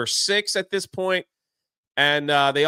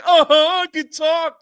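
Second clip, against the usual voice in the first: much higher and louder, a cartoonish little voice; squeaky Dopey; 11 semitones higher, 7 dB louder than usual. Dopey proudly confirming that he can talk, in a loud, high squeaky voice. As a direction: chirp it like a proud little dwarf.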